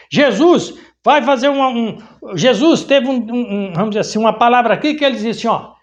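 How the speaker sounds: ordinary talk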